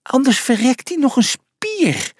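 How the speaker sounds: high, fretful mouse voice; alarmed little mouse squeal; exclaiming. A narrator's reading. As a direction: cry out in high-pitched worry. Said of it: "riep Pliny uit".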